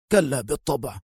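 understated firm denial. A narrator's reading. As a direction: dismiss it firmly, without raising the voice. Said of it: "أجابه شوكت في حزم". (بحزم)

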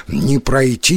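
plain reading voice

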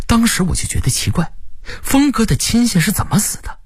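ordinary delivery, nothing marked

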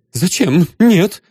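put-on high, startled girl's answer; high startled denial; frightened young lady. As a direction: blurt it out like a scared young lady. Frightened girl answers detective.

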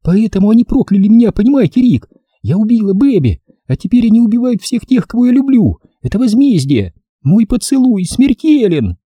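high-pitched frantic female confession; frightened. Acted. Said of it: "продолжала она тем же испуганным".

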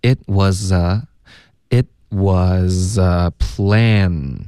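slow "it was a plan", "was" and "a" run together; linked was-a. Shown as a demonstration. Teacher shows learners how was and a link, slow linked "was a". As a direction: stretch it out, sliding "was" into "a". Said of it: "was跟a要连读".

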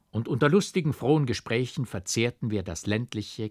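plain reading voice

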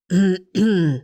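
a man's throat clearing to get attention. A narrator's reading. Stranger clearing his throat to interrupt, throat clearing. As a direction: clear your throat to announce yourself. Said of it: "подал голос незнакомец".

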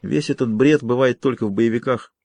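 ordinary delivery, nothing marked

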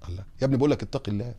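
normal talking voice

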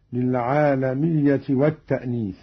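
usual speaking voice

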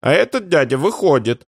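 put-on high childish voice, insisting; high-pitched kid's protest; indignant little boy. A narrator's reading. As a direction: contradict mum like a small child. Boy keeps protesting to mother.

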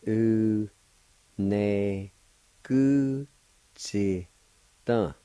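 each syllable drawn out and separate; syllable-by-syllable pronunciation. Shown as a demonstration. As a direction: break the word into long, separate syllables. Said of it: "it's pronounced like this".

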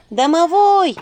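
high loud call. Acted. Heroine calling the house spirit. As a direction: call out toward the stove. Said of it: "позвала я".

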